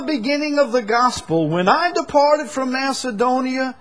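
high-pitched, forceful pulpit delivery; impassioned preaching. Acted. Preacher telling the congregation not all churches followed suit, impassioned.

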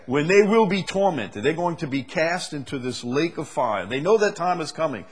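high, loud preacher's build; fiery sermon delivery; preaching at full force. Acted. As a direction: drive the point home from the pulpit.

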